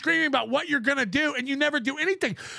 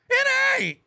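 pitched up high, mocking; high defiant taunt; 10 semitones higher than usual. Shown as a demonstration. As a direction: sing it out high.

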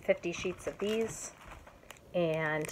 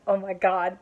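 ordinary delivery

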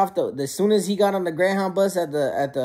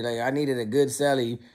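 normal talking voice

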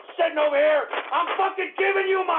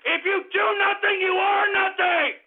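shouted slogan; 4 semitones higher than usual. Real, not acted. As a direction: bellow it at the listeners. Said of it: "repeats it shouting".

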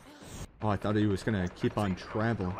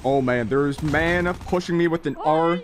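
loud, raised-pitch worry; worried; 5 semitones higher, 5 dB louder than usual. Real, not acted. Player being pushed by an enemy, loudly worried. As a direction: fret loudly as the threat closes in. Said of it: "I'm very worried".

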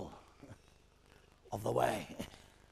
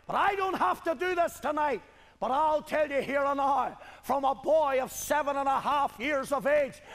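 high, loud, pressing testimony; fervent preaching; 5 semitones higher, 3 dB louder than usual. Real, not acted. Preacher testifying to his congregation since boyhood, fervent preaching. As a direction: pour it out clause after clause.

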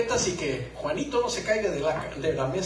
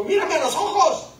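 put-on high, loud, laughing little girl's voice; a small girl insisting, high and loud; little daughter's demand; 9 semitones higher, 5 dB louder than usual. Little daughter demanding her father's attention, high and loud. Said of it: "me dijo".

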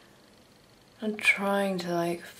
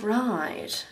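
usual speaking voice

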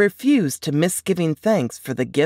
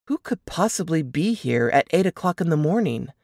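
ordinary delivery

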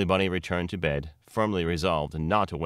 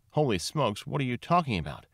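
higher-pitched baffled reader; mock disbelief; 6 semitones higher than usual. A narrator's reading. Narrator mimics reader objecting to the tale, mock disbelief. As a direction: play the reader who won't buy it.

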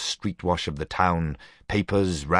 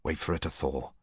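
low murmur, much softer; 3 semitones lower, 9 dB quieter than usual. A narrator's reading. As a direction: keep it hushed, just for Leslie. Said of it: "I murmured".

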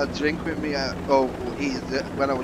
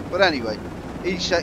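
ordinary talk

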